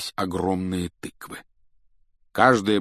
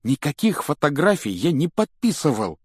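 high, indignant denial; fervent protest; heated exclamation; 7 semitones higher, 4 dB louder than usual. A narrator's reading. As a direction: deny it hotly, pitch raised. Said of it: "с жаром воскликнул он".

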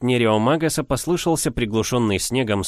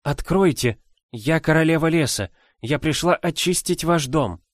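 higher, smiling seductive voice; charming woman voice; 7 semitones higher than usual. A narrator's reading. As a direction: lure them in with a smile. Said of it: "Женский голос, в котором слышалась очаровательная улыбка".

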